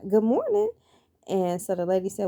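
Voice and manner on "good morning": high, louder cheery good morning; cheery greeting. Retold or imitated. Greeting the lab lady first thing in the morning, high and cheery. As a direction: sing out the greeting to a stranger.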